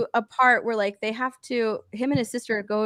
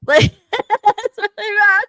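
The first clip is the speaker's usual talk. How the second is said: laughing; 11 semitones higher, 7 dB louder, slower than usual. Real, not acted. Laughing while complaining about show.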